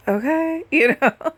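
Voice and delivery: retold nervous giggling okay; nervous laugh. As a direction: laugh it off uneasily. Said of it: "we kinda nervously laugh".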